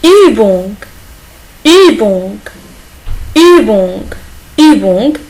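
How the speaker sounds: "Übung" with rounded German ü, each take slow and clear; German ü demo. Shown as a demonstration. Teacher drills the class on German Übung, careful ü. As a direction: model "Übung" for French learners, rounding the lips on ü. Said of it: "Il se prononce U".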